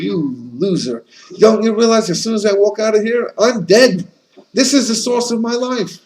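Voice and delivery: the fish turns the fox down with scorn; scornful fish. Acted.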